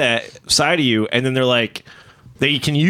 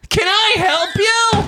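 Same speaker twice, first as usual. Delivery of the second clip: very high, drawn-out mock shout; mock confrontation; much slower than usual. Friend imitates driver yelling out the window, hammed-up shout. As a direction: holler it slowly at the other driver. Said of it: "You were like, you said".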